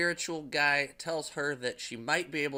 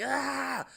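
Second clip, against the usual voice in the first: scream; 12 semitones higher than usual. Retold or imitated. Cursed woman hears ringing ears.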